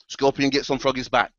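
usual speaking voice

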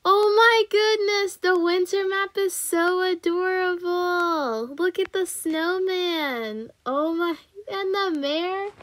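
gasp, then high, drawn-out gushing; thrilled, stretched-out high squeal of delight. Real, not acted. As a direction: coo over something adorable, high and slow. Seeing the winter map, delighted.

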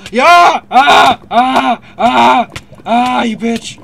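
loud high screams; screaming. Real, not acted. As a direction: scream in triumph then outrage. Victory, then betrayal in game.

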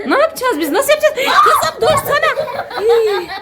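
very high, half-laughing protest; exasperated squeal. Real, not acted. Mother begs her toddler to stop, exasperated squeal. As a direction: plead helplessly, pitch shooting right up.

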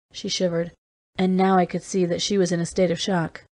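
ordinary delivery

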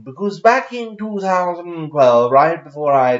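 exaggerated put-on storytelling voice; silly put-on voice. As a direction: ham it up in a stupid affected voice. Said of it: "I'm gonna stop this stupid voice".

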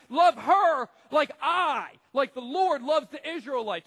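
loud, high, slow booming voice of God; impassioned divine command; passionate command. Acted. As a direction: thunder it as God pleading with passion. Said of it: "You can hear God's voice, a passion coming out".

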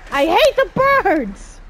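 high, loud angry shout; furious yell. Real, not acted. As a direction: yell it in frustration. Attacked by game birds.

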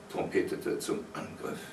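ordinary delivery, nothing marked

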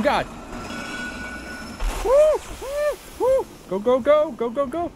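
cheering herself on; frantic urging. Real, not acted. Racing to escape.

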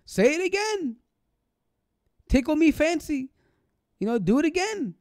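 high, eager mocking plea; mock gleeful request. Mocking the judge begging the poet to repeat, high and gleeful. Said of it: "he laughs and gloats".

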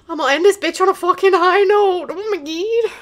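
triumphant. Real, not acted. Triumphant sign-off.

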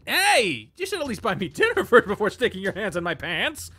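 high, loud indignant protest; indignant yelp. Acted. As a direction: squeal it out, half laughing. Cole protests, mage on him.

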